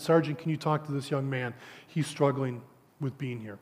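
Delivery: soft, hushed request; quiet aside. Retold or imitated. Pastor quietly asking the sergeant to help the boy, hushed. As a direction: murmur it so the boy won't hear.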